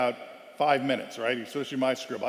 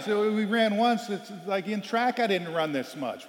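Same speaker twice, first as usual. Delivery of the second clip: higher, slow whine; whining player; 6 semitones higher, slower than usual. Retold or imitated. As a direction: whine like a sulky teen. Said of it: "complaining and whining".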